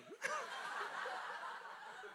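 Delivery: airy high-pitched giggle; high breathy laugh. Real, not acted. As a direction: crack up in a wheezy laugh. Cracks up at child's joke.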